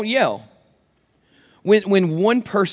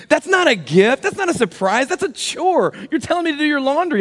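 much higher, mock-indignant scolding; indignant wife; 11 semitones higher, 3 dB louder than usual. Wife scolding husband over laundry basket gift, high mock-indignant. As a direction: play the offended wife, pitched way up.

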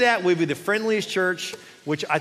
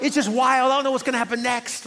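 higher-pitched imitation of an exasperated complainer; mock frustration; 6 semitones higher than usual. Habakkuk complaining to God about the chaos, retold with frustration. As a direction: retell a prophet's bewildered complaint in a raised pitch. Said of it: "he's frustrated".

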